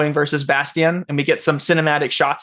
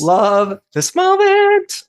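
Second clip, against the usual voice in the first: gushing, high and drawn out; excited; 14 semitones higher, 4 dB louder, much slower than usual. Real, not acted. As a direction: swoon over the moment. GM gushing over the moment.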